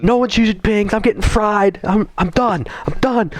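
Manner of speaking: high-pitched, fed-up rant; re-enacted anger. Player retelling his rage quit to friends, mock fed-up rant. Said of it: "I was so mad".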